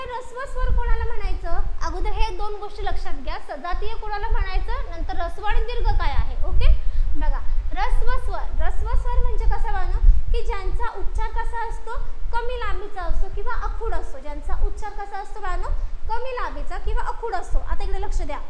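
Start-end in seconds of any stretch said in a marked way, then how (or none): none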